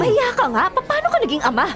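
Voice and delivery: high, sharp indignant rebuke; woman scorning the father who abandoned her child. Acted. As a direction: shame him, outraged.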